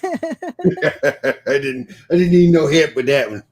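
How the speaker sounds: real chuckling as speaking; laughing through words. Real, not acted. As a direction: talk through your own laughter. Laughing at own remark.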